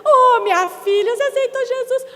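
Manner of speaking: high, loud, gushing and near tearful; thrilled, pulling her to the front; overjoyed churchwoman. Retold or imitated. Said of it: "e falou assim".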